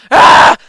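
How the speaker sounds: loud, short cry of alarm; sudden loud outburst; frustrated yell. Real, not acted. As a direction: yell it, thwarted. Blocked while rushing in game.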